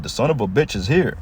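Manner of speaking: ordinary talk, nothing marked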